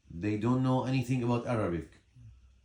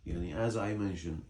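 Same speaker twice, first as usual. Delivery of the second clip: ordinary talk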